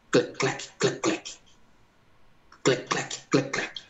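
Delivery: soft breathy clec clec of the eye machine; machine clicking. Retold or imitated. Imitating the eye machine for the church, a soft clicking. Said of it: "ela fazia assim, ó".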